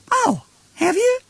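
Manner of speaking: very high, slow puzzled joey; puzzled Roo. Acted. Roo puzzles over being caught, high and puzzled. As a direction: ask it like a confused little one. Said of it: "in a rather puzzled sort of way".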